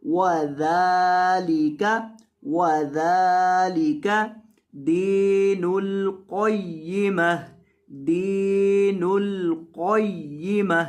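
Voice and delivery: slow, drawn-out tajweed recitation. Shown as a demonstration. Teacher recites the verse ending for students, slow chanting. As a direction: chant each word slowly and clearly.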